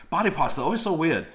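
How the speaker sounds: clumsy mock British accent; bad British accent. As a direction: butcher a British accent on purpose. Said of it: "I'm gonna fuck up a British accent here".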